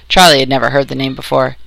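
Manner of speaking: ordinary delivery, nothing marked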